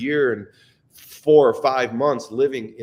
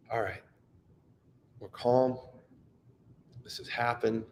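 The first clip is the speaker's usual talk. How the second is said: calm acceptance; 3 semitones lower, 8 dB quieter than usual. Retold or imitated. Father reaching acceptance after the diagnoses, calm and soft. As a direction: breathe out and accept it gently. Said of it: "acceptance of grief is acceptance".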